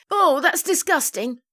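high-pitched recoil; disgusted. A narrator's reading. Daughter hears of Mum's romance, disgusted.

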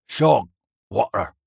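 slow, thick dwarf mumble; slurred dwarf voice, drawn out; muttering dwarf. A narrator's reading. Dazed dwarf answers the man bending close, muttering. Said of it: "Nameless muttered something".

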